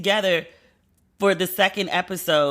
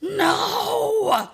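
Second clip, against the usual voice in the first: dramatic no; 12 semitones higher than usual. Retold or imitated. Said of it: "Remember Ghost was trying".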